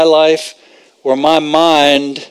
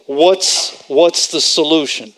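ordinary talk, nothing marked

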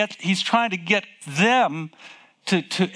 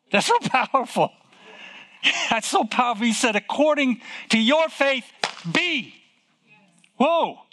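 real excitement, pitched higher; excited; 6 semitones higher than usual. Real, not acted. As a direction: marvel at it as you preach. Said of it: "I tell you, that's exciting".

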